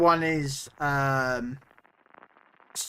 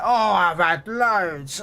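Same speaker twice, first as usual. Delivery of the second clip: put-on drunk punter; 4 semitones higher, 3 dB louder, much slower than usual.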